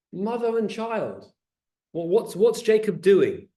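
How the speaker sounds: ordinary talk, nothing marked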